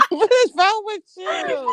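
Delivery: can't stop laughing at her; laughing disbelief. Real, not acted. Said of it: "What are you laughing at?".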